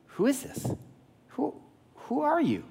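high, soft, bewildered questioning; puzzled old father. Acted. Blind father questioning his visitor, puzzled. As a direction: voice leaps up, softly unsure who's there.

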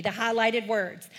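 ordinary delivery

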